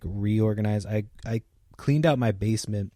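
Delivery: ordinary delivery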